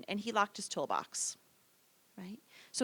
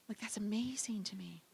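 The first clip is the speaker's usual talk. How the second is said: whisper; 8 dB quieter than usual. Real, not acted. Aside about kids in shorts.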